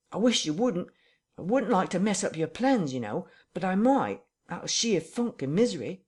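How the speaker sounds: frightened tramp pleading with his captor; near tears. Acted. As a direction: beg like he might cry any second. Marvel pleads.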